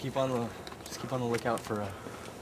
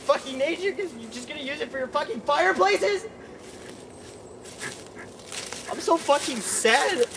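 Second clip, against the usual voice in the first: mock grief. Continues mock nature grief.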